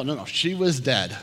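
usual speaking voice

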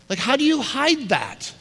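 high, incredulous question. Real, not acted. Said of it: "incredulous rhetorical".

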